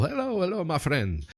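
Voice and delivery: put-on high, Italian-accented hello. Valdemar waving to Cole, greeting in an Italian accent. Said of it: "I'm speaking like an Italian accent".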